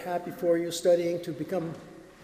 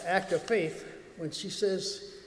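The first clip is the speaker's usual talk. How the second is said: ordinary talk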